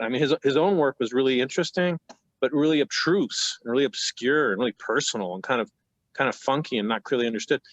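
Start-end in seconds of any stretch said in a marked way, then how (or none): none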